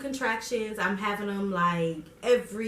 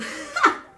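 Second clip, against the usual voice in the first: high bright laugh; a quick burst of laughter; 5 dB louder than usual. Real, not acted. Laugh after birth.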